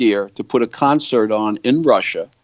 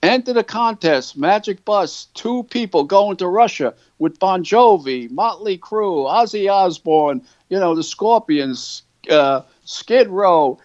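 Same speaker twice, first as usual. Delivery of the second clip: mock ad announcer; 5 semitones higher than usual. Ad on screen read aloud.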